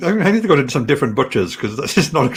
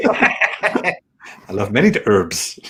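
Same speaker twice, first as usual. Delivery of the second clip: slow, laughing put-on voice; 4 semitones lower, much slower than usual. Laughing mock accent.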